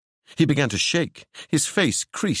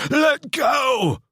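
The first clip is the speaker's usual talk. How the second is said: high, roaring command; roared; 3 dB louder than usual. Acted. Ewan roaring at Seb to let go. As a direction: roar it at him. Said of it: "Ewan roared".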